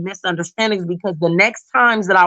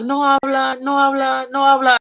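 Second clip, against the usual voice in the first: mock refusal; 5 semitones higher than usual. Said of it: "all of 'em was like".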